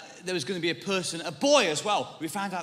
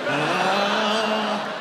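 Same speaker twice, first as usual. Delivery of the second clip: long, loud, high mock "ooh"; knowing "ooh"; 5 semitones higher, 6 dB louder than usual. Friends reacting to a declined offer, exaggerated knowing ooh. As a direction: imitate a group catching on with one rising ooh.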